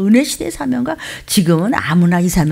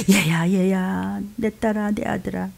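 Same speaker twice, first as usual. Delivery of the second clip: put-on slow, softer, fading call to a child; tender calling; 5 dB quieter, slower than usual. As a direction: call gently, letting each word fade. (자식을 부르듯 느리고 부드럽게)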